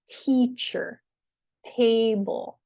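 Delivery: TEAcher, TAble, first syllable punched; "teacher", "table" with strong first beat, weak second; stress on first syllable. Shown as a demonstration. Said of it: "the first syllable is stressed".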